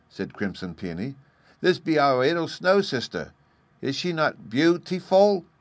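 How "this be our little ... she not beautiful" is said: put-on high, loud small-child voice; childish delight, pitched way up; little child. A narrator's reading. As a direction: show off proudly like a toddler. Little Peony shows off snow sister.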